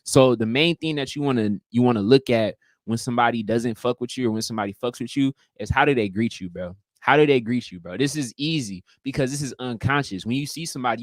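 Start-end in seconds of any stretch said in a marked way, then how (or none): none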